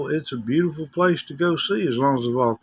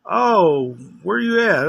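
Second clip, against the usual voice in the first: curious caller; 5 semitones higher, 3 dB louder, slower than usual. Retold or imitated. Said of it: "they would call in and they'd say".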